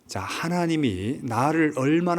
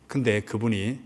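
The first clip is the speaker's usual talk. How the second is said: ordinary talk, nothing marked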